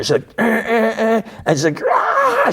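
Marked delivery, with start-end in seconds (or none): 0.4-1.2 high honking eh sounds; imitated car horn. She leans on the horn at Mark, honking. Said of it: "she wails on the horn". 1.9-2.5 shrill high tyre screech cry. Retold or imitated. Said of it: "She, like, screeches".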